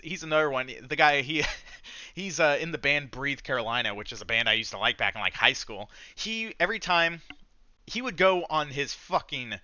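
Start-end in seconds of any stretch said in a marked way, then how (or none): none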